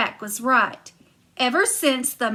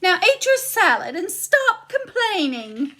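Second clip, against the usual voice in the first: much higher, loud shrill-free insisting wife; high giantess; 8 semitones higher, 4 dB louder than usual. Acted. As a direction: push it up high and bright. Giantess bosses husband.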